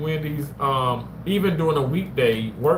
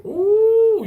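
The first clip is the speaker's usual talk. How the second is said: excited squeal; 6 dB louder than usual. Real, not acted. Reacting to a food comment.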